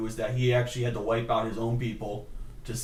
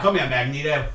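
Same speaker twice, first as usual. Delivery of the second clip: mock Australian; 4 dB louder than usual. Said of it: "Australian Wolverine".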